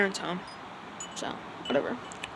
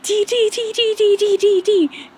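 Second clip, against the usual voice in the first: very high, loud repeated squeals; screaming; 11 semitones higher, 9 dB louder than usual. Retold or imitated. Acid girl running off with her picture, screaming. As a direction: shriek it over and over like gleeful gossip. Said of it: "just screaming".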